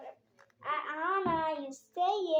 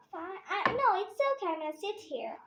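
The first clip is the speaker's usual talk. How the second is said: normal talking voice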